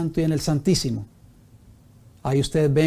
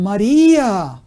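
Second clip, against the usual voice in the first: «María» exclaimed louder and higher; 5 semitones higher, 6 dB louder, much slower than usual. Shown as a demonstration. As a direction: call out «María» with wonder. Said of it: "en signo de admiración". («María» exclamado con fuerza y más agudo)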